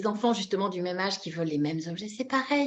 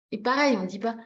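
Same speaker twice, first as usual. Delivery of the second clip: usual speaking voice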